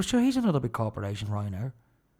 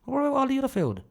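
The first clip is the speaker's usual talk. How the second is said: incredulous rhetorical question; 10 semitones higher, faster than usual. Real, not acted.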